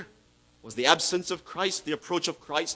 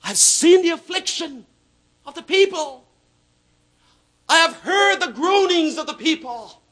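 higher, louder preaching; preaching at full force; 9 semitones higher, 6 dB louder than usual. Acted. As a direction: proclaim it, ringing out.

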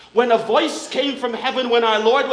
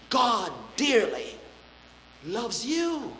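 tender hush; 7 dB quieter, slower than usual. Real, not acted. Preacher softly assuring discouraged hearers of God's love. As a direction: lay it gently on each listener.

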